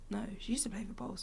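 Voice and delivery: hushed aside; whispered correction. Real, not acted. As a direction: say it softly under your breath.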